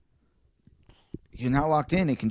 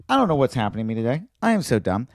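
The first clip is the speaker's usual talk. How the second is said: mock flustered; 3 semitones higher, 4 dB louder, faster than usual. Human excusing mistake.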